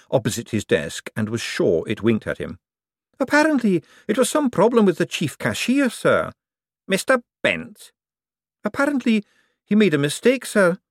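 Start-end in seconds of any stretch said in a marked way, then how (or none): 3.2-10.8 high, anxious clerk voice; nervous clerk. Acted. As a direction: report it anxiously. Heretofore reports.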